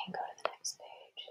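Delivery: breathy unvoiced whisper aside. Real, not acted. Parent whispering to the child to turn the page.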